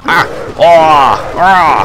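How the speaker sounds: loud monster roar; zombie noise. Pretend. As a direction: roar like a snow beast. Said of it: "or a Wampa noise at least".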